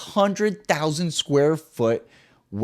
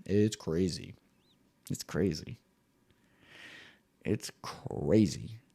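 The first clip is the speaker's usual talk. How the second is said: fading to quiet; 6 semitones lower, 11 dB quieter, slower than usual. Acted. Repeated fading musing.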